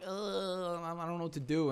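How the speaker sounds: high mock hesitation with a drawn-out "uh"; flustered improviser. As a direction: imitate someone floundering for an idea. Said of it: "that was more like improv, like".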